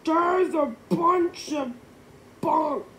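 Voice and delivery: high, loud scoffing yell. A narrator's reading. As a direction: shout it down in disbelief. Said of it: "yelled Melvin".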